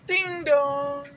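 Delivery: high sung ding dong; door chime. Retold or imitated.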